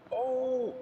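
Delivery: high, loud startled cry; surprised shout. Real, not acted. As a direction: burst out in surprise. Sudden exclamation in game.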